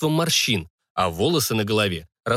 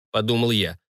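usual speaking voice

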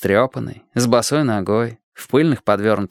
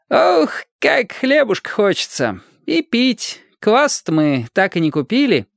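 put-on much higher, slow whiny boy hanging upside down; hungry kid voice; 11 semitones higher than usual. A narrator's reading. Boy dangling from a bar begs for bread, whiny and high.

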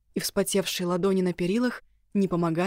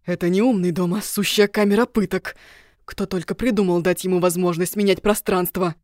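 loud furious ranting; angry rant; 6 dB louder than usual. Acted. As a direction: vent at the house, loud and fed up. Elf rants about trap house.

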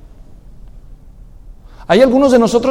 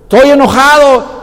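stubborn sulk; 6 semitones higher, 5 dB louder than usual. Acted. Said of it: "stubborn angry reply".

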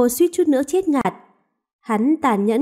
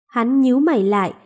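ordinary delivery, nothing marked

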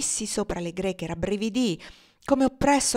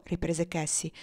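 ordinary delivery

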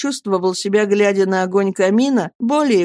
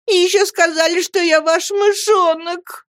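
tearful complaint, acted; 11 semitones higher than usual. A narrator's reading. Dora recalls his pet name, high tearful complaint. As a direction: whine it high, stretching the end. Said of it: "рыдала Дора".

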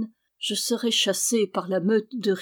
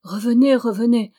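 raised, insistent call; urgent calling; 3 semitones higher, 7 dB louder than usual. A narrator's reading. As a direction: call out louder, pleading for a return. Girl urgently calls prisoner back.

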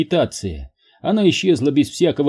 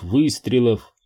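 plain reading voice

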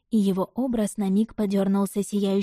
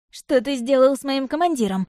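higher, challenging cry at the beast; warlike shout; 5 semitones higher, 4 dB louder than usual. A narrator's reading. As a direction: shout it at the wolf, blade drawn. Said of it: "выкрикнул он".

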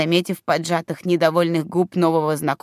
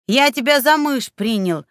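indignant; 6 semitones higher than usual. Acted. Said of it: "возмущённый голос эльфа".

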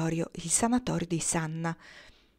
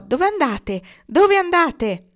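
high, loud call, much higher on the repeat; shouted question; 9 semitones higher, 8 dB louder, slower than usual. A narrator's reading. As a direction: call out from a window. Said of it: "una si sporse ... mano e gridò".

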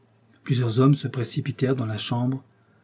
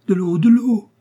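high, loud, urgent shout; shouted plea; 9 semitones higher, 8 dB louder than usual. A narrator's reading. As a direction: shout for help in a panic. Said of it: "criait D'Artagnan". (cri pressant, fort et aigu)

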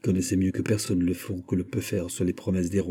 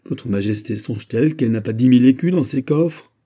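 higher, louder incredulous question; protestation; 4 semitones higher, 6 dB louder than usual. A narrator's reading. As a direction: protest aloud, voice rising through the question. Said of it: "s'écria Anne d'Autriche". (question indignée, plus haute et plus forte)

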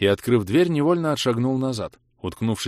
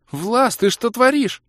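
high, loud, drawn-out female cry; stunned shout; 6 dB louder, slower than usual. A narrator's reading. Kira bursting into the hall at Vlas, stunned shout. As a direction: cry out in shocked protest. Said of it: "крикнула обалдевшая Кира".

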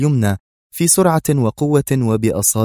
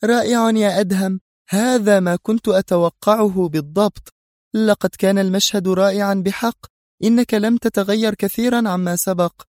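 high-pitched gleeful villainess; 10 semitones higher than usual. A narrator's reading. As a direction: relish the show you have just watched. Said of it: "وبجذل واضح". (بجذل واضح وطبقة عالية)

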